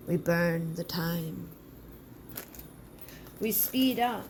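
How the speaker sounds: slow, solemn spell chant with long pauses; ritual incantation. Acted. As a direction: call on the powers slowly, like casting a spell. Leads a spoken ritual spell.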